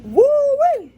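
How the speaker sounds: high, loud whoop at the burn; real burning whoop; spicy woo-wee. Real, not acted. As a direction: cry it out as the heat grows. Spice growing.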